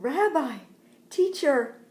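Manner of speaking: high, loud delighted cry; joyful recognition. Acted. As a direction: exclaim as you recognize a beloved teacher. Mary joyfully recognizes Jesus.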